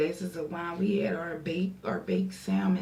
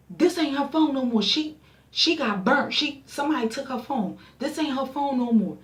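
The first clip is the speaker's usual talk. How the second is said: raised, sassy telling-off; firm brush-off; 6 semitones higher than usual. Retold or imitated. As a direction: shut the caller down hard. Friend lying to stalker on phone.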